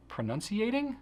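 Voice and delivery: pronunciating rising high, unsure; pronunciating asked in disbelief; puzzled question. Real, not acted. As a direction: catch yourself on a made-up word. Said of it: "I think I just made up a word".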